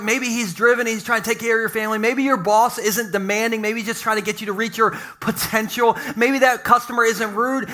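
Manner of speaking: usual speaking voice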